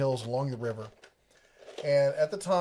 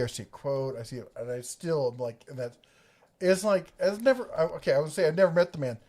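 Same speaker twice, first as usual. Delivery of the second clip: ordinary talk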